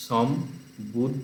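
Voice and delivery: সম্বুদ্ধস্ব slowly as som - bud - dhos - so; slow practice split of সম্বুদ্ধস্ব; broken into syllables. Shown as a demonstration. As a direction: drill সম্বুদ্ধস্ব with a gap after each syllable. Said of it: "ভেঙে ভেঙে অভ্যাস করতে হবে".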